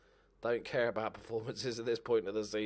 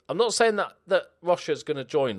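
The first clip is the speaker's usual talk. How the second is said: loud tease; 6 semitones higher, 8 dB louder than usual. Real, not acted. Teasing a transfer to players.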